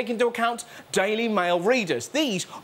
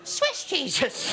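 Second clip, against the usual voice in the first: put-on Irish accent; 5 semitones higher, much slower than usual. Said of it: "with the Irish accent".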